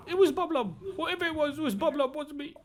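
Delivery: very high, squeaky denials; panicky goblin. Acted. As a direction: insist it wasn't you, squeaking. Goblin denies being Bob Lob.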